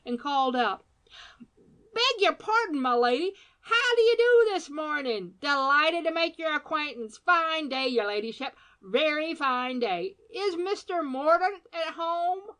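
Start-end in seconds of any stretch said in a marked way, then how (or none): 1.9-12.6 high, loud wheedling gentleman; cheery caller; Badger calling out. A narrator's reading. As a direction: call through the door, all ingratiating charm. Badger calling out to unseen lady.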